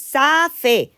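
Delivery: "Safe" read as two Spanish syllables, SA-FE; wrong Spanish-style reading. Retold or imitated. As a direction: sound out every letter of "Safe", final E voiced. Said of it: "Y decíais".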